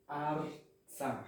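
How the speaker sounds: "arza" in two slow syllables, no vowel after the r; careful teacher's model of "arza"; correct pronunciation. Shown as a demonstration. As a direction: say "arza" with the r closing the first syllable. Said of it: "E não areza".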